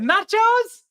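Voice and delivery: high, drawn-out, hammed-up Mexican accent; mock Mexican accent. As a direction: overdo the Mexican accent like a mother-in-law ordering. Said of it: "starts speaking in a Mexican accent".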